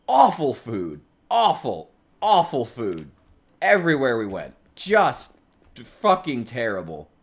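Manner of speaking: loud, punched words with falling ends; emphatic disgusted rant. Real, not acted. Streamer ranting to chat about British food, emphatic disgust. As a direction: hammer each damning phrase separately, loud and exasperated.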